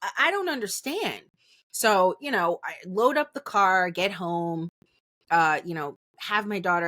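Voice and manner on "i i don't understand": high-pitched, disbelieving whine of confusion; high baffled outrage. Retold or imitated. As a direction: play the wronged innocent, pitched way up. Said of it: "And I was hot".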